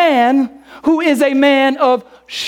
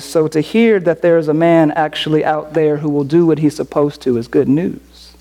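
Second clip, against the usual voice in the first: ordinary talk, nothing marked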